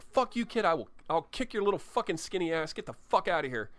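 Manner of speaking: mock-furious shout at the kid running out the door; yelling after him.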